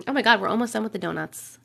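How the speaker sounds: normal talking voice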